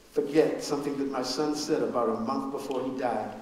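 usual speaking voice

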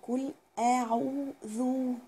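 أعوذ with the tongue tip out on the ذ, very slow; slow lisped dhal demo; interdental dhal. Shown as a demonstration. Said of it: "لازم أطلع فيه طرف اللسان".

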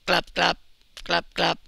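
loud, short duck noises; punchy paired duck calls. A narrator's reading. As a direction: quack out the syllables like a duck.

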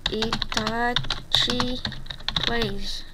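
very slow, word by word; dictating slowly. Real, not acted. Gamer giving a player the channel name, slow dictation. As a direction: spell it out for someone writing it down.

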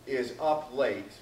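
ordinary talk

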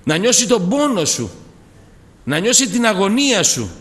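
loud, high, fervent preaching; preaching at full force. Acted. Preacher praising Jesus to the congregation, full force. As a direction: lift the whole church with it.

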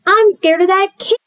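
high-pitched frightened squeak; scared kid voice. Acted. Second scared kid tells of fear, high and alarmed.

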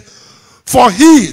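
"For he" hit high and loud; loud, lifted stress on "he"; emphasis on HE. Real, not acted. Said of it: "this is my emphasis".

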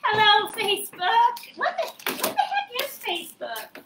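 high quavery granny voice; playing a sweet old grandma; high, slow little-old-lady voice. Granny confused by Facebook.

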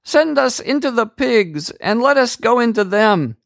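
very high, eerie pleading voice of many spirits; demons begging. A narrator's reading. Evil spirits beg Jesus to send them into pigs, very high plea. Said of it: "so the spirits begged Jesus".

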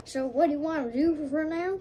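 ordinary talk, nothing marked

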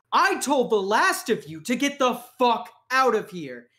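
furious shout. Acted. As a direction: blow up at him, full rage. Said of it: "He says".

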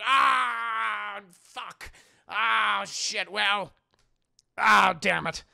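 loud, high groaned cursing; exasperated surrender. Acted. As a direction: groan and curse as you give in. Old man reluctantly giving in.

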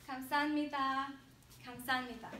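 감사합니다 run together, the 합 swallowed; smooth and fast. Shown as a demonstration. Teacher repeats thank you for students, 감사합니다 smooth and fast. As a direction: say 감사합니다 as one quick fluent word. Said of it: "as smooth and fast as possible".

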